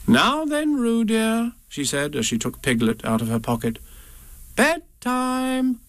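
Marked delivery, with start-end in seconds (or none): 0.1-1.5 high, sing-song mother voice; motherly Kanga. Acted. 4.6-5.8 high, sing-song mother voice; motherly Kanga. Acted.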